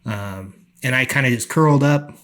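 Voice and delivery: usual speaking voice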